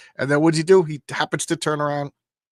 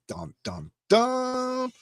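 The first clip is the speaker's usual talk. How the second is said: dramatic dun dun dun; 9 semitones higher, 4 dB quieter, much slower than usual. Pretend. Host mocking the drama of the letter, sung sting. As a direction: hum a cheesy soap-opera sting.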